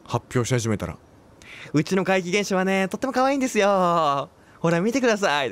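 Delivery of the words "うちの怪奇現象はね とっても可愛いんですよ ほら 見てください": higher, bright-show presenter voice showing off the house's ghost; morning TV presenter. Retold or imitated. Presenter mimicking a morning show segment, cheerful host voice. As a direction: play a cheery breakfast-TV host. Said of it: "今日のワンコみたいなノリで、目覚ましテレビで".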